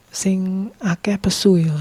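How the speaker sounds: slow, thick Javanese medok with Jawa said as Jowo; playful Javanese accent, slowed down. Shown as a demonstration. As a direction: drawl it out like a Central Javanese. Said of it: "Langsung keluar medok Jawanya".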